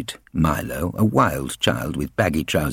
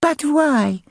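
put-on high, lisping small child; lisping little girl. Little sister questioning the bullies, innocent lisp. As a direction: ask it with a little girl's lisp. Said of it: "lisped Milo's little sister Eliza".

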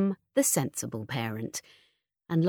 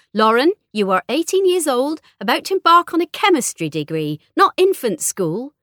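loud, raised telling-off; very firm; 8 semitones higher, 6 dB louder than usual. A narrator's reading. Mother's imagined reply to her daughter, very firm and loud. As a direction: lay down the law, pitched up and forceful. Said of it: "in a very firm voice".

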